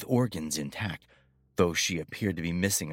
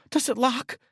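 high, loud frightened question; crying out in terror; panicked cry; 5 dB louder than usual. A narrator's reading. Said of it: "she cried".

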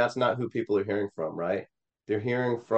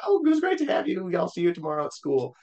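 high-pitched chirpy friend's goodbye; oblivious friend; 10 semitones higher than usual. Retold or imitated. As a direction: imitate a friend saying a carefree bye, pitched way up.

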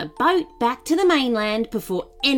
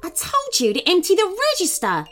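high-pitched, impatient shout; shouted order; 11 semitones higher than usual. A narrator's reading. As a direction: order a clerk about forcefully. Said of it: "she shouted at the clerk".

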